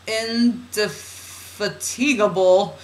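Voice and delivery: INDEFATIGABLE stretched slowly, syllable by syllable; drawn-out word. Shown as a demonstration. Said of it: "saying that word fast like that".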